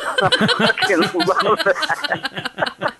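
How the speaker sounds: laughing through the words, high; cracking up while saying it. Real, not acted. Host cracking up over a friend's tweet, laughing.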